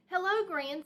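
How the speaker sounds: high, loud, bright phone hello; cheery greeting. A narrator's reading. Mama Bear greets Grizzly Gran on the phone, high and loud.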